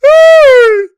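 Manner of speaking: a sudden shrieking laugh at a bad play; screaming laugh. Real, not acted. Furious fan shrieks with laughter at the TV. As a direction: let out a shrieking cackle.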